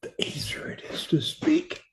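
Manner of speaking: unvoiced soft whisper. Shown as a demonstration. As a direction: show how soft goes. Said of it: "the softer you speak".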